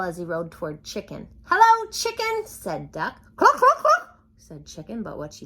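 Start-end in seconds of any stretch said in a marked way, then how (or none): 1.4-2.4 much higher, louder duck greeting; cheery duck. A narrator's reading. Duck riding past the farm animals, high cheery greeting. 3.4-4.2 very high, loud clucks; hen clucking. A narrator's reading. Chicken answering Duck, loud high clucking.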